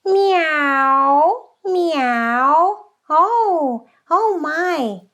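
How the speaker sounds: high, loud nasal meowing; nasal exercise. Shown as a demonstration. Teacher demonstrating a nasal warm-up to students. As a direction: meow through the nose like a cat. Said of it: "make a quite nasal sound, like".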